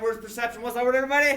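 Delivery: very loud, high call-out to a class; big, high-pitched teacher's shout; rallying call. Real, not acted. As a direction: belt it out so everyone answers. Rallying class for louder repeat.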